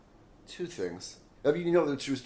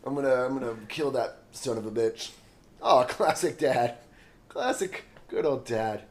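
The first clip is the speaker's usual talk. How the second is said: sarcastic; 4 semitones higher, 8 dB louder than usual. Acted. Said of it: "sarcastic comment on dad joke".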